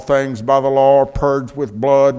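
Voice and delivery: loud, ringing preacher's reading over music; scripture read with force. Acted. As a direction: proclaim the verse to the whole church. Preacher quoting scripture loudly.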